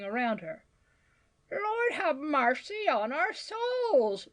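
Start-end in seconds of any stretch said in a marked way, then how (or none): 1.5-4.3 slow, high cry of terror; terrified wail. A narrator's reading. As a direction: wail a prayer in horror. Old woman discovers the smoke.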